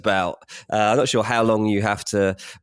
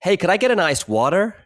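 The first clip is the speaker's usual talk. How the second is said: higher-pitched mock American accent; put-on American accent; 9 semitones higher than usual. Expat ordering water to be understood, put-on American accent. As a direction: order like an American, a touch overdone. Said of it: "put the accent on".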